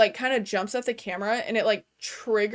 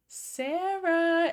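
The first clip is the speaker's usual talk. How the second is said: creepy mimic calling; 7 semitones higher, 3 dB quieter than usual. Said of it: "this thing that's mimicking her mom".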